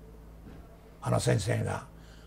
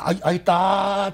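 slow, drawn-out comedic あいたー; milk the late reaction; stage comic reacting late to a slap, slow and hammed up; delayed comic ouch; 4 semitones higher, 3 dB louder, slower than usual. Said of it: "とか言って、ちょっと遅れて受けたりする".

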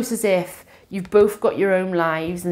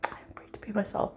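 soft unvoiced whisper, a little quick; 3 semitones higher, 12 dB quieter, faster than usual. Shown as a demonstration. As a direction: breathe the affirmation out as a secret. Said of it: "repeats affirmation in a whisper".

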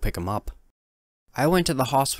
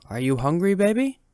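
coaxing mother; 10 semitones higher, 4 dB louder than usual. Acted. Mother coaxing her son.

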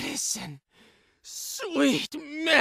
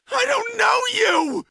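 higher, frantic shouted denial; agitated outburst, pitched up; escalated denial; 6 semitones higher than usual. Acted. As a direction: cry it out in rising panic. Same line, escalated on repeat.

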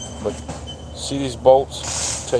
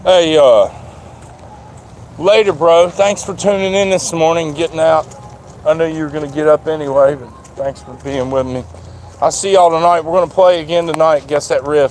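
raised, louder voice, pitched up; calling out goodbye; 5 semitones higher, 5 dB louder than usual. Real, not acted. Vlogger thanks a bro for tuning in this morning, calling out loudly. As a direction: call a friendly send-off across the lot.

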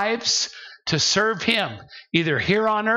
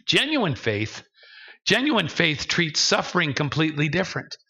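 normal talking voice

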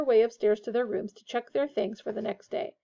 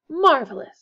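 loud, higher burst of mock delight; mock-grand delight; 5 semitones higher, 9 dB louder than usual. A narrator's reading. George seizes Harry's hand in turn, mock-grand. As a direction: gush with exaggerated ceremony. George mocks Percy's pomposity.

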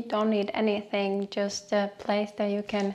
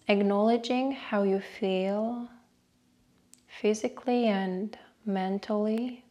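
guided meditation. Acted. Host leading listeners through a short breathing meditation, guided meditation voice. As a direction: guide them unhurriedly, letting each phrase settle.

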